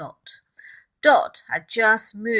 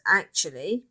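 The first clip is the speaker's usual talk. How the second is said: usual speaking voice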